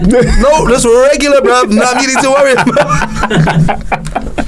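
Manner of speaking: high, laughing tough-guy bluster; hammed-up street bravado, guarding a secret; mock defensive bro.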